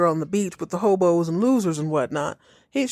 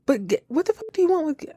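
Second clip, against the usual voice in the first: exasperated outburst; 8 semitones higher, faster than usual. Real, not acted.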